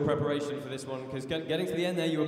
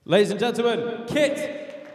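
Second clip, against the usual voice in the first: higher, louder crowd call, name thrown up high; announcer introduction; 6 semitones higher, 5 dB louder, slower than usual. Real, not acted. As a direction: present him to the arena. Announcer presents the fighter.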